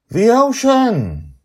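high, slow, loud cry of joy; a girl calling out her delight; excited child Joy. Acted. Joy points down at the ocean, crying out high and loud.

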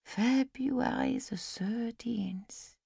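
slow, faraway whisper; wandering whisper. A narrator's reading. Old Lizzie, mind wandering, whispers the date slowly. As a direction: breathe it as if lost in memory. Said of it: "Her mind seemed to be wandering".

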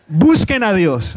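BUSQUEN stretched high and loud, an urgent exclamation; exclamatory, with intention. Shown as a demonstration. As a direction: hit "Busquen a Dios" slowly as a loud exclamation. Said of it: "Ahí es donde está el signo de admiración". ("Busquen a Dios" exclamado con intención)

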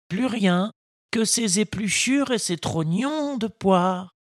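put-on a patient old woodcarver advising his boy; kindly old Geppetto. Geppetto voiced in story.

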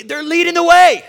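high, loud rise on the last words; triumphant storyteller's yell; rousing shout. Acted. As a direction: belt it out, climbing to a peak. Exclaims the climax, then repeats it.

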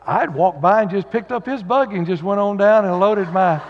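ordinary talk

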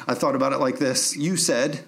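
ordinary talk

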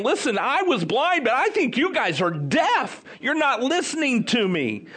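high, exasperated taunt; mocking. Healed man to questioners who won't listen, mocking them. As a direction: poke fun, pitched well up. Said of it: "he's making fun of him, saying".

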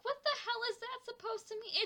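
high, fast mock outrage; incredulous retold protest; retold indignation. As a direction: react in rushed, high-pitched disbelief. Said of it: "And I'm like".

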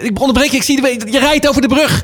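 high-pitched, urgent excited shouting; excited calling out. Real, not acted. Host spots Freek's train on the bridge, excited calling out.

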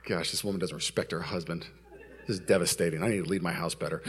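low, soft brooding; inner thought. Retold or imitated. Husband brooding after the fight with wife, low inner voice. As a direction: think aloud, low and grave. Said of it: "I'm thinking".

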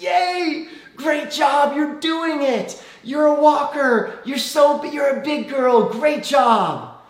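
high, loud delighted cheering; cheering parent. Retold or imitated. Parent cheers daughter's first steps, high and shouted. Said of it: "what do we say as parents?".

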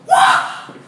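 very high, loud mock holler; imitated loud voice. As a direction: imitate a startling shout from behind. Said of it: "loud voice go".

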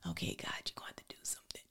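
urgent hushed plea to God; whispered prayer. Retold or imitated. Panicked retold prayer to God.

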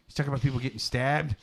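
ordinary talk, nothing marked

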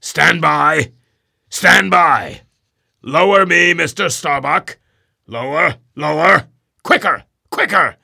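loud, high barked commands; shouted orders. A narrator's reading. Ahab commanding the boats, shouting loud orders. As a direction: drive the crew hard.

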